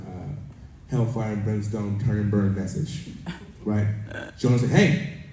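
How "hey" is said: high, loud shout for attention; calling out. Acted. Jonah hails the people of Nineveh, loud call. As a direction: hail a whole crowd with one high call.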